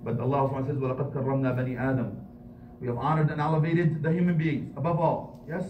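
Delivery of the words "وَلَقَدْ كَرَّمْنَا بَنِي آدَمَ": rapid recited verse; quick Quran quote. Acted. Imam quoting the Quran to worshippers, quick recitation. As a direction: recite it briskly.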